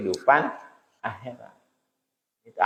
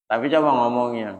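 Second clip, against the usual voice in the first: ordinary delivery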